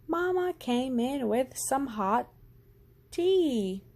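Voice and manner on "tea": plain reading voice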